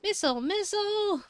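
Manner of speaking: alarmed shout. Acted. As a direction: yell at the dog to stop. Calling dog in alarm.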